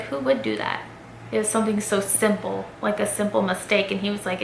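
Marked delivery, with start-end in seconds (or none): 0.0-0.8 softer, echoing it to herself; inner thought. Retold or imitated. She repeats his words in her head, softly. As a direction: say it quietly as a private realisation. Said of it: "And then in my head, I was like".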